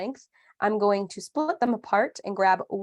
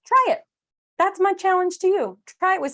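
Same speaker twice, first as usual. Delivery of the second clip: challenge; 10 semitones higher, 4 dB louder than usual. Real, not acted. Playful challenge to viewers.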